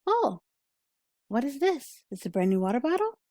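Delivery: high, curious perked-up surprise; surprised. Retold or imitated. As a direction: sound suddenly intrigued. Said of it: "Your ears perked up a little bit, like".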